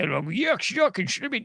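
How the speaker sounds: garbled mock comedian voice, rising high; Bill the Cable Guy. Podcaster shows a comedian's fake stage voice, mocking impression. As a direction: imitate a comic's put-on shtick in nonsense words. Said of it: "he doesn't sound like that".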